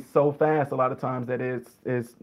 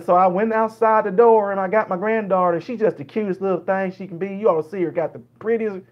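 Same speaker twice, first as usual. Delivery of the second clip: higher-pitched rambling Southern storyteller; slow-to-the-point Southern dialect; 5 semitones higher than usual. Acted. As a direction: ramble sociably in a Southern accent, in no hurry. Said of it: "so they'll go all the way around".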